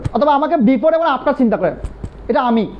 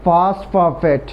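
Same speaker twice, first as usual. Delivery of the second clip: stretched out very slowly and lower; slow drill; 8 semitones lower, much slower than usual. Shown as a demonstration. Teacher dictating the rule, slow and drawn out. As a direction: drag each syllable for the class. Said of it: "লেখেন before-এর আগে".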